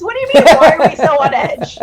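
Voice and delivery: indignant outburst. Acted. Character snapping back at Bud's question, incredulous and loud.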